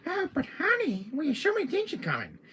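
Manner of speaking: high-pitched coaxing; piping, teasing little villain; Salazar voice. Acted. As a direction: wheedle in a silly little high voice. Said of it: "who's doing the Salazar voice?".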